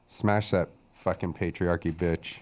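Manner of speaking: low, soft mocking chant; low muttered taunt. Mock chant at co-host.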